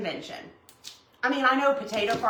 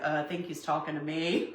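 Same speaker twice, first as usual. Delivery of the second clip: imitating the other guy; 7 semitones lower, 7 dB quieter than usual. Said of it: "He's like".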